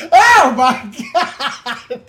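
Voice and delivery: slow, loud, high oh my God; excited exclamation. Real, not acted. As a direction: drag it out, amazed. Excited about TV.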